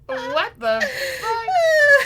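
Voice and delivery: high squeal through laughter; laughing. Real, not acted. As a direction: crack up while asking it. Laughing fit at friend's joke.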